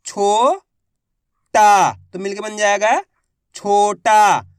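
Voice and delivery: syllable by syllable, then blend छोटा; sounding out. Shown as a demonstration. Teacher shows students how to blend छोटा, sounding out. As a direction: say छो, then टा, then join them into छोटा. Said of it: "उसी तरीके से आपको भी पढ़ना है".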